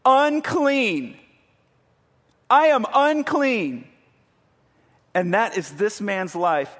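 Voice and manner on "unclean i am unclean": high, loud cry, drawn out slow; yelled warning. Retold or imitated. Leper warning passers-by away, loud slow yell. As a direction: cry it out so nobody comes near. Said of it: "he would have to yell".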